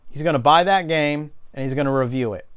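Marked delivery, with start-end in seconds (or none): none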